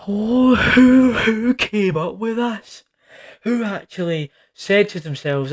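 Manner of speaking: high, baffled disbelief; incredulous. Real, not acted. Disbelief at the film.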